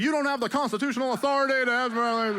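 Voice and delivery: high, fast indignant rant; sovereign citizen. Retold or imitated. Driver lecturing the cop at a checkpoint, high and fast. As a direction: rattle it off like a know-it-all. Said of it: "the guys were like".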